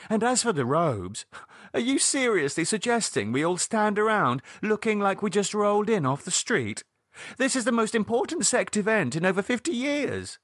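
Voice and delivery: high-pitched, outraged insistence; indignant quarreller. Acted. Arguing over robes for the big sect event, indignant. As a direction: argue as if the occasion is being insulted.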